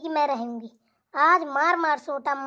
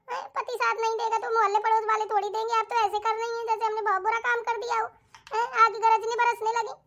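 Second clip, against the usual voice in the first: whiny wife; 2 semitones higher than usual. Acted. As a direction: whine your innocence, hammed up. Wife answers back.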